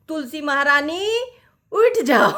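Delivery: firm, sarcastic command to get up; harder tone. Retold or imitated. Mother-in-law sternly ordering the lazy bride up. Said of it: "मेरी सास का स्वर थोड़ा सा ऐसा, अ, कड़ा होता था".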